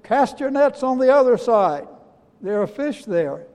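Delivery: high, loud call across water. Acted. Man on the shore calls to fishermen, calling out. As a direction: call it out to a far-off boat. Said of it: "somebody walking on the shore and calling out".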